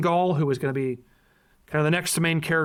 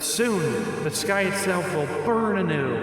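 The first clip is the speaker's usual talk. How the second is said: dramatic narrator voice. Acted. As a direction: read it like a fantasy trailer. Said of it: "With your narrator voice".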